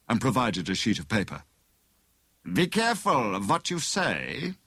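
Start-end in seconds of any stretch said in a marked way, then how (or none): 2.5-4.6 high, foreign-tinged villain warning; menacing. A narrator's reading. Bogus inspector warns letter-writing prisoner, menacingly. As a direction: warn him with controlled threat. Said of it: "Dymchurch said menacingly".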